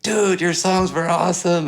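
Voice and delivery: high-pitched, enthusiastic praise; mock excited fan. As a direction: imitate a festival-goer gushing. Retold praise from festival crowd.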